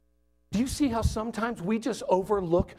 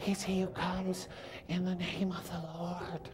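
very soft, slow near-whisper; mock whispered praise; 12 dB quieter than usual. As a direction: cheer in a careful hush so nothing gets startled. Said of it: "those who followed whispered quietly".

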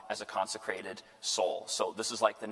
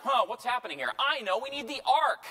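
much higher, mock-puzzled then bright idea; mock clueless soldiers; 12 semitones higher, 5 dB louder than usual. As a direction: act out losers hitting on a silly plan. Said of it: "and they're like".

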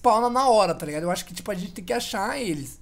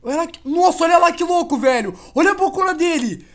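excited shout; 6 semitones higher, 8 dB louder than usual. Real, not acted. Awed by alien mouth.

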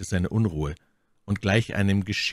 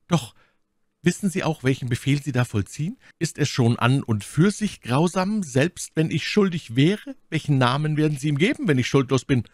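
higher-pitched accusing lady; My Lady, vorwurfsvoll; 8 semitones higher, 3 dB louder than usual. A narrator's reading. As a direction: challenge his orders as the wronged prisoner. (vorwurfsvoll fragende Lady, hohe Stimme)

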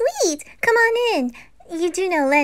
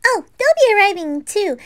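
usual speaking voice

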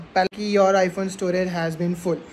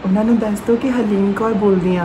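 fake Harleen Kaur; 4 dB louder than usual. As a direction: play a polite Punjabi woman calling a stranger. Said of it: "ना कि कोई और हरलीन कौर".

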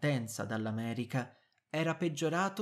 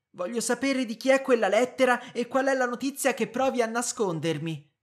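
insistent demand; 10 semitones higher, 9 dB louder than usual. Acted. Wife demands the truth.

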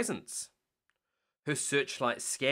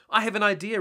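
higher, louder announcement; announced; 8 semitones higher, 6 dB louder, faster than usual. Acted. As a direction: proclaim it to the pups. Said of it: "Ryder announced".

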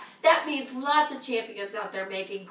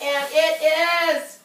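slow, drawn-out lead-in; suspense build; 4 semitones higher, 5 dB louder, much slower than usual. Real, not acted. Teasing the hundred dollar winner, slow suspense.

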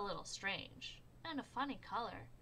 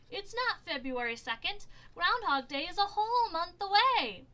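high, loud outrage; outraged shout; 10 semitones higher, 13 dB louder than usual. A narrator's reading. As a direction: keep yelling the date.

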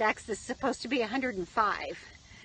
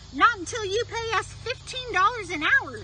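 shouting; 9 semitones higher than usual. Acted. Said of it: "shouted the cow and the horse".

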